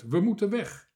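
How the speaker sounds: loud, high alarm call; urgent shout. A narrator's reading. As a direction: shout for everyone to leave at once. Captain sounds whistle, orders departure.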